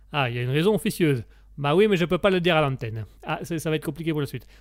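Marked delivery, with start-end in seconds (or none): 1.6-3.1 evasive mock Italian accent; sing-song Italian accent, holding back. Italian guest refuses to tell on air, evasive Italian accent. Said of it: "comme on l'entend à mon accent".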